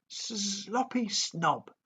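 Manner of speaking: high, slow sing-song taunt; taunting dragon call. A narrator's reading. Toothless taunts Fireworm in midair, high slow sing-song. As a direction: drawl the insult out to tease. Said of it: "Toothless called out".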